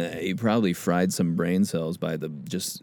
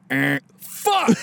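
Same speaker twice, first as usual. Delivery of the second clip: put-on frustrated cussing kid; 13 semitones higher than usual. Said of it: "spelling bee kids have, like, cussed".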